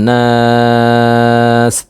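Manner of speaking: নাস with the aa held three counts; three-alif stretch. Shown as a demonstration. Teacher shows the three-alif stretch on the final word. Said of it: "তিন আলিফ টান".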